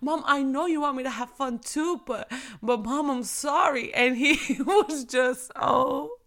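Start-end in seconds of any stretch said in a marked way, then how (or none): 0.0-3.9 put-on a touch of sweet child voice, pleading; cute little boy. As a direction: play the sorry son sweetly. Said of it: "he was talking so cute".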